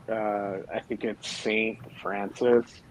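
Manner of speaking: ordinary talk, nothing marked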